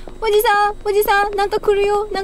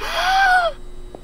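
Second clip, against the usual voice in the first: startled high gasp; sharp gasp; 9 semitones higher than usual. Real, not acted. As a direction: gasp in fright. Sudden scare in horror game.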